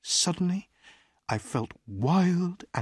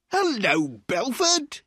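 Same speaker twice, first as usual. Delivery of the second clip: loud greeting; 9 semitones higher, slower than usual. A narrator's reading. Fat man greets the lawyer in the hotel, crying out loudly. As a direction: hail him in a raised, higher-pitched voice. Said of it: "he cried loudly".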